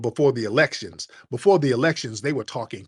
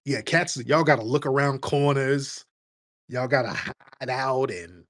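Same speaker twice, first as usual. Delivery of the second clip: ordinary talk, nothing marked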